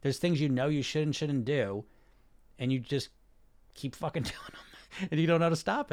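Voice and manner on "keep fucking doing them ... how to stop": laughing through it. Real, not acted. Laughing mid-rant about bad habits.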